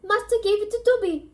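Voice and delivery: high squeaky elf voice; house-elf voice. Acted. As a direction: play Dobby, high and eager. Narrator voices Dobby the elf.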